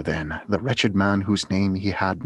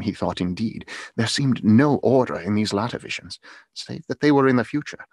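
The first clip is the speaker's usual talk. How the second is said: ordinary delivery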